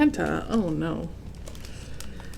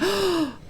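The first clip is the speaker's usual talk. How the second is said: high gasp; 12 semitones higher than usual. Acted. Santa's joyful surprise.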